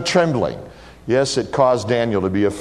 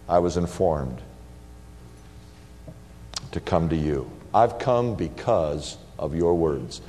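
gentle; 7 semitones lower, 6 dB quieter than usual. Acted. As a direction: reassure him quietly. Jesus speaking to Daniel.

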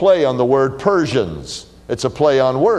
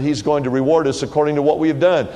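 usual speaking voice

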